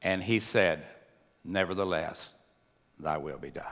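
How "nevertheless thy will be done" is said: low, soft surrender; hushed, resigned prayer in a low voice; calm submission. Retold or imitated. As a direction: say it quietly, sinking lower at the end. Voicing Jesus' calm submission.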